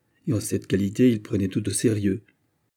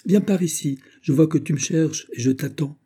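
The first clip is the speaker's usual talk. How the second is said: voix fine et plus haute, appel doux; petite voix; 7 semitones higher than usual. A narrator's reading. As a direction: appeler le vieux serviteur d'une petite voix aiguë. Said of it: "dit la princesse avec sa petite voix". (la princesse appelle Perry d'une petite voix haute)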